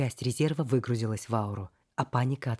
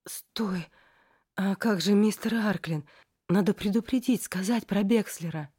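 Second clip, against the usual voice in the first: high anxious pleading voice; panicked friend; 7 semitones higher than usual. Acted. Frightened friend pleads urgently.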